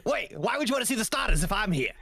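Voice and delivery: mock-offended mascot diva; Quilladin. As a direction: protest like a vain cartoon character. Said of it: "Are we gonna see you, Quilladin?".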